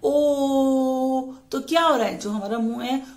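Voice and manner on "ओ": the sound ओ drawn out, round-mouthed; long o sound. Shown as a demonstration. Teacher modelling the vowel for kids, drawn out. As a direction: show the vowel ओ clearly. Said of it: "जब हम ओ बोल रहे हैं".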